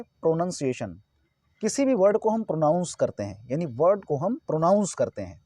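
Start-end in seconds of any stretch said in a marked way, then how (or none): 0.0-1.0 "प्रोनाउंसिएशन" with a short 'nun' in the middle; correct pronunciation. Shown as a demonstration. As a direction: say "प्रोनाउंसिएशन" as pro-nun-see-AY-shun. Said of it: "यानी right क्या है". ("प्रोनाउंसिएशन" में 'नाउन' नहीं, छोटा 'नन' बोलें)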